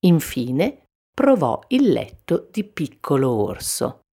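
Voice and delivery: plain reading voice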